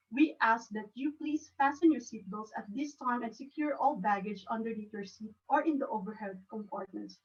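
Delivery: steady, polite pre-flight announcement; calm flight attendant. Shown as a demonstration. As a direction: soothe the passengers before takeoff. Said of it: "You have to do it in a, in a calm manner".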